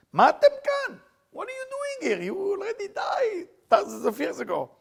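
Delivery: high, far-off cry of shock, rising to a shout; God crying out in disbelief at the dead; shocked high cry, breaking into a shout; startled outcry. Acted.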